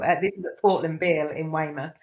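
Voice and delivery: ordinary talk, nothing marked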